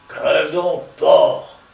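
loud, spat-out insult; vicious curse. Acted. Limerick to Strong in the vat, vicious loud curse. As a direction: curse him hard, loudest on the last word. Murderer curses his drowning victim.

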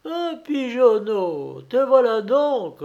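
storybook sheep's head, pitched right up; bleating sheep. A narrator's reading. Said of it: "bêla une tête de mou".

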